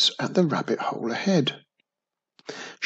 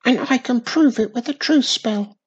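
tiny witch voice; 10 semitones higher, 4 dB louder than usual. A narrator's reading.

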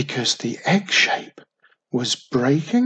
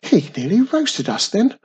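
higher, louder indignant protest; indignant complaint; 8 semitones higher, 6 dB louder than usual. A narrator's reading. Wisby complains to Wiz that he was nearly roasted, indignant. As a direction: protest that you are the one in danger. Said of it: "complained Wisby".

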